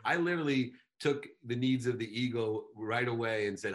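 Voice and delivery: ordinary talk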